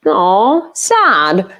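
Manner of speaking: hammed-up sad aw; mock pity. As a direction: fake sympathetic aw, sad.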